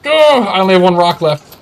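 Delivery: panicked. Real, not acted. Panicked after missing.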